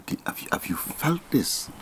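hushed, breathy whisper of a question. Retold or imitated. Asking the people whether they felt it, whispered. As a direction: whisper it as if checking quietly with someone.

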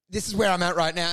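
normal talking voice

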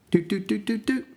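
high, even beeps of a keypad; high-pitched "doot" dialing noises; imitated dial tones. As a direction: mimic phone buttons with high doots.

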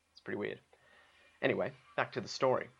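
normal talking voice

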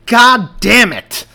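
a full-throated curse bellowed out; furious shout. Acted. Cop yelling at the skyscrapers in despair, furious shout. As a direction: roar it up at the sky, slow and huge. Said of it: "shouted toward the tops".

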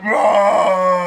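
loud beastly roar; roaring stag. Retold or imitated. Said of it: "he's just there like".

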